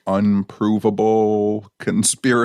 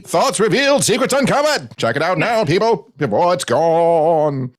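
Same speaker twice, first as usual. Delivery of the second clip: put-on high, booming old-time announcer; old newsreel voice; 9 semitones higher than usual. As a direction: sell it like a vintage newsreel. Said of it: "That voice is the voice of all the fonts".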